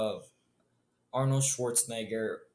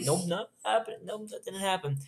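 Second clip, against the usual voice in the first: hasty denial; 6 semitones higher than usual. Acted. Acted flustered denial of what happened.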